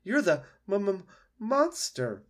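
high, slow, stuttering alarm; frightened stammer. Acted. Opossum facing the monster, high fearful stammer. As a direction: stumble over the dreaded word.

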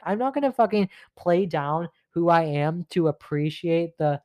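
ordinary talk

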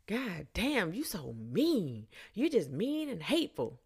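high, swooping mock complaint; riled-up man. Drunk man scolding woman who said no, mock-aggrieved. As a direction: imitate his wounded protest, stretching the words. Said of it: "His voice is starting to raise".

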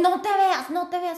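high, loud don't look; imitating him blurting out a warning; frightened boyfriend stopping her from looking; urgent warning.

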